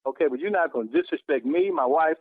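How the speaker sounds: ordinary delivery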